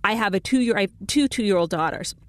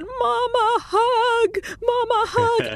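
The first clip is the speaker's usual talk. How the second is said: put-on high little-girl voice pleading for a hug; tearful two-year-old asking mama for a hug; toddler voice; 13 semitones higher, much slower than usual. Mother imitating her toddler daughter, high and pleading.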